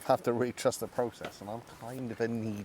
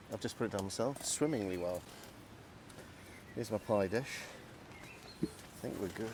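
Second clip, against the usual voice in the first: normal talking voice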